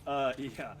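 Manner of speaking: ordinary talk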